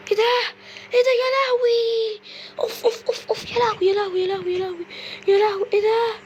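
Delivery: high, crying wails of real panic; screaming as the head chases her; frightened crying out. Real, not acted. Terrified by chasing head.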